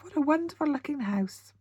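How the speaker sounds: ordinary delivery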